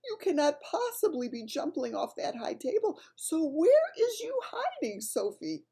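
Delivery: a frightened friendly giant searching for Sophie; desperate giant. A narrator's reading. BFG calls desperately for hidden Sophie, high and anxious. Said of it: "he thought desperately".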